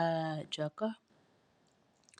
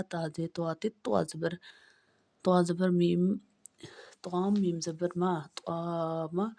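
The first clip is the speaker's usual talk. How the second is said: normal talking voice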